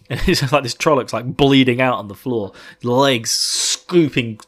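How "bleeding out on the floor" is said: ordinary delivery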